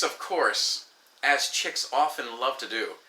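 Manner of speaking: ordinary talk, nothing marked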